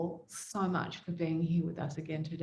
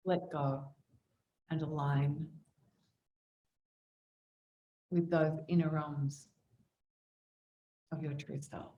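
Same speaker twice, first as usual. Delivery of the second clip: guided meditation prayer. Acted. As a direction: settle them into stillness, unhurried and level.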